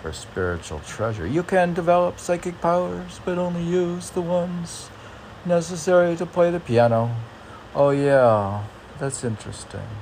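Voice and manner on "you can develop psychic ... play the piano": much higher, announcing quote voice; reading a curious line to a friend; reading quote aloud. Shown as a demonstration. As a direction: lift the line up high like a proclamation. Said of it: "reading a quoted line aloud".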